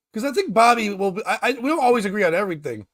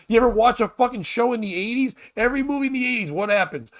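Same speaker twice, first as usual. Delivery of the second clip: ordinary delivery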